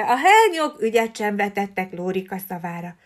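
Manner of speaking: plain reading voice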